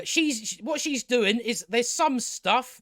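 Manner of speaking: usual speaking voice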